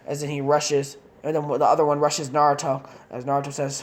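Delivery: plain reading voice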